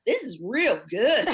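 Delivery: loud, slow Missouri drawl; Missouri hometown accent. Retold or imitated. Guest re-enacting her wine reaction, excited Missouri accent. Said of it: "he can hear my accent".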